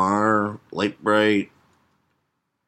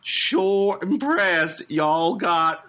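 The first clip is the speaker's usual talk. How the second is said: very slow, higher Southern drawl; Southern cowboy accent; 8 semitones higher, much slower than usual. Acted. Cowboy flatters the woman, thick Southern accent. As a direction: stretch each word like a Georgia cowboy. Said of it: "serious Western cowboy and some motherfucker".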